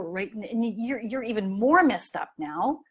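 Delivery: normal talking voice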